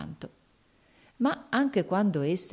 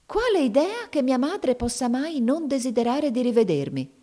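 high, affronted outcry; storybook character flushing with indignation; indignant exclamation; 8 semitones higher, 3 dB louder than usual. A narrator's reading. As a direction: exclaim it, pitch shooting up in outrage. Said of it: "esclamò Mamie diventando di porpora".

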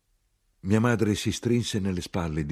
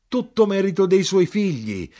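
high, loud excited cry; full-voiced, high-pitched exclamation; shouted; 8 dB louder than usual. A narrator's reading. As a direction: shout it out with fervour. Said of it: "io gridai".

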